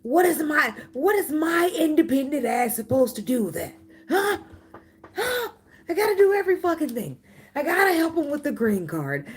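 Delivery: high, loud mocking put-on voice, half laughing; mocking the commenter. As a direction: play the outraged commenter, way up high. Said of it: "You like".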